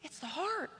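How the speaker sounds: ordinary talk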